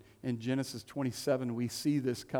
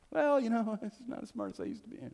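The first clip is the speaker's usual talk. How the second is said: modest brushing off; 9 semitones higher, faster than usual. Retold or imitated. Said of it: "parent deflecting praise".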